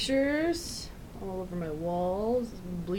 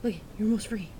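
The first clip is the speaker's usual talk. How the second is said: normal talking voice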